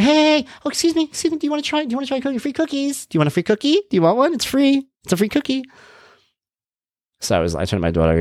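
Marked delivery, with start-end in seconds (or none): 0.0-5.6 high-pitched, eager sales pitch; pushy cookie vendor. Retold or imitated. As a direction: imitate a woman offering free samples. Acting cookie vendor in story.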